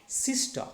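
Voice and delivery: "Sister" with SIS high, ta falling; first-syllable stress. Shown as a demonstration. Teacher models the rule, "Sister" stressed on SIS. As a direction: hit SIS in "Sister" and drop the end. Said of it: "stress should be on".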